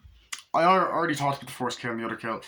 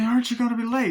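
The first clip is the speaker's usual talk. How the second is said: much higher and louder, shouted out; high shouted dad line; 12 semitones higher, 4 dB louder, faster than usual. Shown as a demonstration. Fan showing how the father yells it, high and loud.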